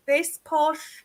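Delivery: lightly put-on posh English voice; posh English accent. Shown as a demonstration. Voice actor showing the host a posh English accent. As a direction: give a small sample of posh English. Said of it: "I can do a bit posh".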